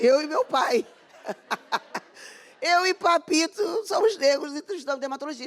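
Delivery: giggling proud confession; amused, bubbling over; laughing. Real, not acted. Laughing while telling the story.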